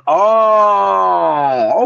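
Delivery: long drawn-out oh of dawning understanding; acted realization. Rapper retelling learning who he sounds like, drawn-out realization. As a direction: stretch it out as it sinks in. Reacting to the comparison, retold.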